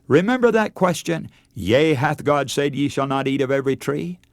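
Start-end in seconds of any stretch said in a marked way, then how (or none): none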